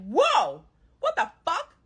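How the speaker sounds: shocked outrage. Retold or imitated. Thrown out of own home.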